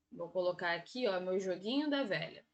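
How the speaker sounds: low, hushed murmur to oneself; quiet aside. Real, not acted. Teacher drawing a grid on the board, quiet aside.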